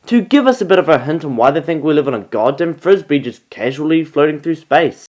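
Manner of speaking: normal talking voice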